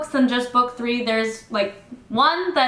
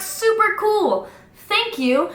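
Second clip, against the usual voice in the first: mock chirpy thanks; 9 semitones higher, slower than usual. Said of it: "they're like".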